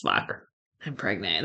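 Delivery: low, soft, stunned mutter; shocked deadpan. Retold or imitated. Learning she is pregnant, low quiet stunned deadpan. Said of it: "I was just like".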